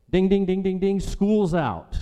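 high, loud bell dinging into a gleeful announcement; playful, high-pitched celebratory call; mock school bell. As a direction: ring it out like the final bell.

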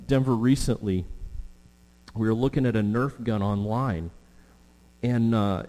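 normal talking voice

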